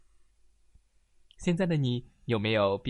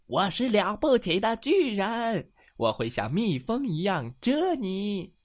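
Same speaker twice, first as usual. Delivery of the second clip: high, loud mock-menacing giant; a playful storybook threat; booming giant voice; 9 semitones higher, 5 dB louder than usual. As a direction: boast like a mighty giant.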